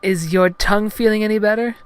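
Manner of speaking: high falsetto, halting start; falter, then ask in high heroine voice; Padme, hesitant. Acted. Heroine dodging her real question, high hesitant falsetto. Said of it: "She hesitated".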